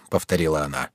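plain reading voice

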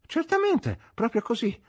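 high-pitched, eager tipsy assent; tipsy agreement. A narrator's reading. Drunk host agreeing to go to theatre, tipsy and high.

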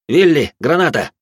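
loud, urgent order; shouted command. Acted. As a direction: call her name and order it. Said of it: "начал раздавать команды я".

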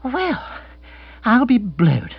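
high, drawn 'well', then emphatic; flabbergasted; astounded exclamation. Acted. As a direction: let out amazement after a breath. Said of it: "Bill drew a deep breath".

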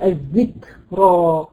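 slow drawn-out tajweed reading of الذكرى; Quran recitation. Shown as a demonstration. Teacher recites a word for the student, slow and measured. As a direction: recite الذكرى slowly with a clear kaf. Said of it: "تقولي تقولي الذكرى".

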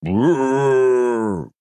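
rolling bear roar. Acted. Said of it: "взревел Лунатыч".

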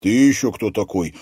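taken aback, on guard; surprised challenge. A narrator's reading. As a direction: demand it in surprise. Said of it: "удивился Матвей".

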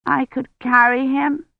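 put-on high, tentative little-girl offer; small child's doubtful plea; not very hopeful. A narrator's reading. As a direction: offer it with faint hope. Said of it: "but not very hopefully".

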